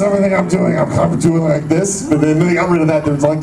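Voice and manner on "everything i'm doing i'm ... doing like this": ordinary talk, nothing marked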